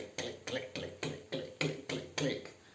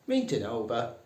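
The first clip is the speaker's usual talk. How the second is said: normal talking voice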